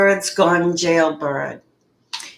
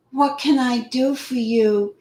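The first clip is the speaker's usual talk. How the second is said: higher-pitched mock child; six-year-old voice; 5 semitones higher than usual. Reading her line from the call, mock six-year-old voice. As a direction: mimic a little girl, pitched up. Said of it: "in my six-year-old voice".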